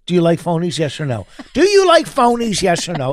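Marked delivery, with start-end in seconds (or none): 1.5-2.6 high, pressing demand for an answer; insistent questioning. Real, not acted. Husband pressing wife for yes or no, insistent and high. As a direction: hammer the question, pitched way up.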